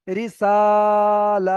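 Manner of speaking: রিসালাতি with সা and লা held equally long; loud sustained সা and লা in রিসালাতি, short রি and তি; two equal elongations. Shown as a demonstration. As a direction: stretch the two middle syllables of রিসালাতি evenly. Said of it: "এই দুটো একই টান হবে".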